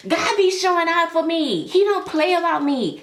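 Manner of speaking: high, loud emotional praise; impassioned testifying at the top of the voice; overcome with gratitude. Real, not acted.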